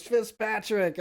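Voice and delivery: slap his back and call the name; guy at the wedding. Retold or imitated. Wedding guest slaps speaker's back, high mock call. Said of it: "and goes".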